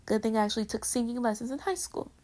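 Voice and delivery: ordinary delivery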